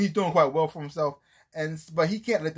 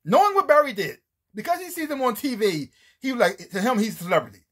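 normal talking voice

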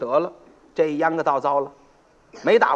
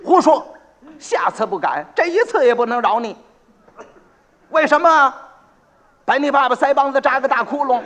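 high, loud angry scolding; angry magistrate; 5 semitones higher, 3 dB louder than usual. Acted. County magistrate scolds the kneeling pot seller, angry. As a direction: scold the accused furiously. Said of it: "知县一听就火了".

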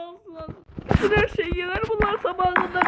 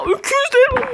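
mock crying; 8 semitones higher, 4 dB louder than usual. Wailing at monsters.

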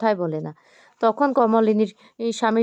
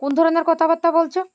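higher-pitched, incredulous repeat of a reproach; indignant demand; 7 semitones higher than usual. A narrator's reading. Mother-in-law challenging her daughter-in-law's words, indignant. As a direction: press the question again, pitched up high.

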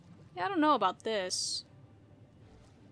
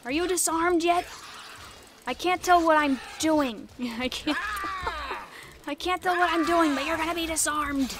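loud, higher, laughing through the fight; frantic excitement; 4 semitones higher, 8 dB louder than usual. Real, not acted. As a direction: chaotic battle yelling.

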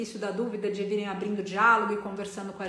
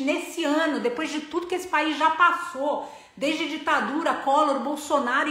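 raised, louder voice, urging people to act; impassioned appeal; 5 semitones higher, 5 dB louder than usual. Real, not acted. Speaker urging viewers to get involved in politics, impassioned. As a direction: plead the case forcefully, pitched up.

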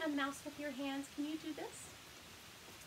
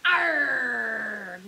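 loud, drawn-out growly arr; pirate roar; 10 dB louder than usual. A narrator's reading. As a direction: roar like a band of little pirates. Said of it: "roar her little pirates".

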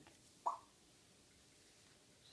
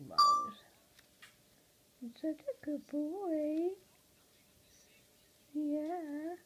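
high squeak of the name, then slow higher cooing; pet talk; 7 semitones higher, 4 dB louder, much slower than usual. Real, not acted. Owner cooing to her parrot Mose, high slow pet talk. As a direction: coo slowly to the bird.

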